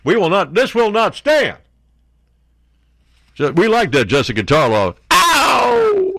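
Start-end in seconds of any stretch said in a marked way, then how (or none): 0.0-1.6 mock defiance. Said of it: "That's what Lee says". 5.1-6.1 high yelp; sudden high yelp; mock whoop. Mock cry at the crowd.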